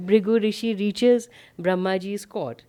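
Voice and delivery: normal talking voice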